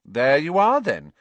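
loud, high swoop then drop; superior. A narrator's reading. Girl winning the argument, superior tone. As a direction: crow it as a point won. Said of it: "in a superior tone".

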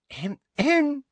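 high, prim throat-clearing; little fake cough to get attention; affected high "hem, hem". A narrator's reading. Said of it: "Umbridge's fake cough".

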